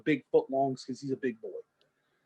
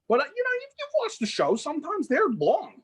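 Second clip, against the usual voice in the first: much higher, excited voice; high squeaky aside; 10 semitones higher, 4 dB louder than usual. Real, not acted. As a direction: squeak it out in falsetto. High-pitched aside about catfish show.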